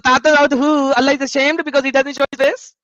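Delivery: garbled, exaggerated Punjabi accent; mock Punjabi accent. Debater echoing his opponent in mock Punjabi accent. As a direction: parrot him in a thick put-on Punjabi accent. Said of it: "I don't understand your Punjabi accent".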